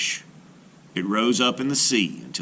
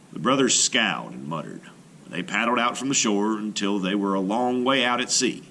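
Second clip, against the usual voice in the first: plain reading voice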